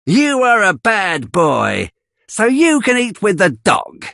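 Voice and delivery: put-on high mean woman sneering at the boy; Missus Sowerberry, scolding. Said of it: "says Missus Sowerberry".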